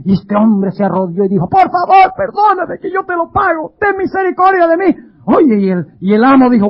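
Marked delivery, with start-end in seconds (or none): 1.5-4.9 pleading debtor. Acted. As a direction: beg the master for mercy. Debtor begging on his knees. (súplica aguda del deudor arrodillado)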